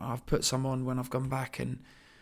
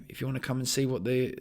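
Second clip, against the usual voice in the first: usual speaking voice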